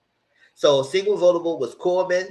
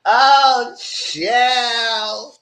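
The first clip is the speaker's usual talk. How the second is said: shocked exclaim; 10 semitones higher, 7 dB louder than usual. Real, not acted. Reacting in shock.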